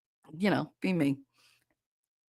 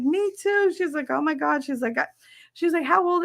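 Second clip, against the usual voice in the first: quick, high bursts of surprise; excited clerk; 10 semitones higher than usual. Retold or imitated. Clerk learning the caller is Italian too, excited. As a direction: imitate high-pitched delight. Retold clerk finding shared background.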